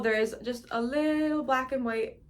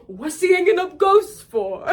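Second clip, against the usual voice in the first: high, loud imitation of the genie; louder, higher genie voice asking about ghosts; genie impression; 8 semitones higher, 7 dB louder than usual. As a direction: ham up the genie's puzzled line. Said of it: "Fucking kills me every time".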